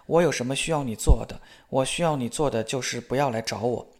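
ordinary delivery, nothing marked

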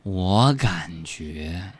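very slow, low, soft sloth talk. Pretend. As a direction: drawl it out like a sleepy sloth. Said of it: "用树懒的方式来说话".

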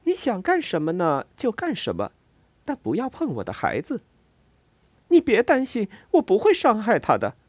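high, half-teasing warning. A narrator's reading. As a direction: tease her but keep it firm. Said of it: "几乎是用一种调侃的语气对他说".

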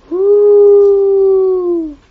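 long, loud high whoo; eerie monster howl. A narrator's reading. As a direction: hoot like a monster echoing from a crater. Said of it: "Yasmin heard a noise".

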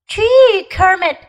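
high, loud put-on surprise; faked surprise. A narrator's reading. Little bear faking surprise to his friend. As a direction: pretend to be surprised. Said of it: "trying to sound surprised".

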